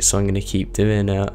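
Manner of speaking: ordinary delivery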